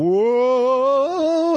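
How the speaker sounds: high, drawn-out cartoon gasp; cartoon whoa. Describing cartoon marbles, a high drawn-out whoa. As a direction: play the cartoon crowd slipping. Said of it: "everyone went".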